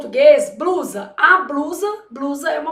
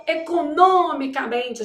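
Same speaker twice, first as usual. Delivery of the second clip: "economicamente" with NO punched as well as MEN; strong pretonic syllable; slower than usual. Shown as a demonstration. Teacher explaining the kept pronunciation, NO stressed in "economicamente". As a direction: hit NO hard in "economicamente". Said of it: "guarda aquela pronúncia".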